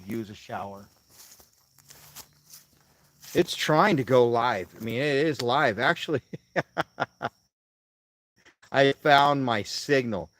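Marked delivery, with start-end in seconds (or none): 3.3-10.3 loud excited. Real, not acted. Excited about live signal.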